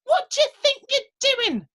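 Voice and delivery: very high, loud, outraged squeak; indignant little man. Acted. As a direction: tell him off from down by his boots. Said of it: "said the little man".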